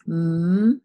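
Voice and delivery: M held as a long hummed "mmm"; drawn-out letter sound. Shown as a demonstration. Teacher sounding out letters for spelling, M as a long hum. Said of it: "think about those sounds".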